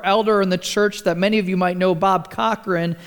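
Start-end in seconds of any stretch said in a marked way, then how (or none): none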